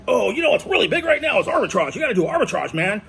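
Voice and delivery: mocking a high, fast-talking Southern salesman; a pushy swindler's sales pitch, pitched high. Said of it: "The fast-talking Southern swindler".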